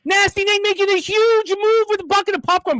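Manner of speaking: high excited race call; race announcer. Acted. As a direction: call the final stretch of the race. Calls the food race.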